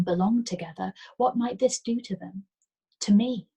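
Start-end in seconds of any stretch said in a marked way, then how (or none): none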